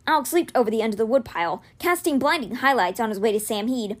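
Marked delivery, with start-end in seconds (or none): none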